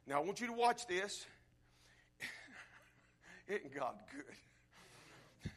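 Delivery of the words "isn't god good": breathy laugh. Real, not acted.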